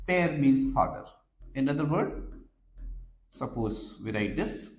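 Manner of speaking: ordinary delivery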